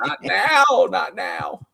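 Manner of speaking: comic whine of refusal; mock protest. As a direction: plead it high and playful. Acting out protest at correction.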